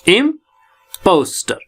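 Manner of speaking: Impostor slowly split as im, pos, ter; slow syllable-by-syllable impostor; three syllables. Shown as a demonstration. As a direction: pause between the syllables of impostor. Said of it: "तो three syllable है".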